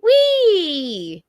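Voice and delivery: high gleeful whee; excited squeal. Real, not acted. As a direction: squeal it with glee. Excited greeting on live.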